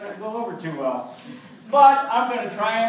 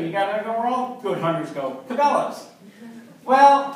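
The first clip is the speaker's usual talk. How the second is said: ordinary talk, nothing marked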